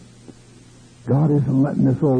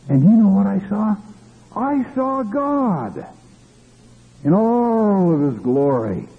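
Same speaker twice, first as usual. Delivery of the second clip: excited prophet; 8 semitones higher than usual. Retold or imitated. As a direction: proclaim the vision in a high, stretched voice. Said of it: "this prophet said".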